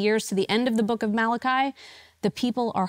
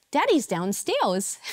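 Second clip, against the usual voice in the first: put-on toddler voice; 5 semitones higher, slower than usual. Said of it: "she would look at me and say".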